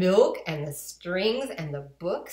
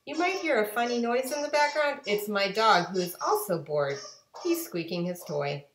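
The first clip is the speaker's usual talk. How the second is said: ordinary delivery, nothing marked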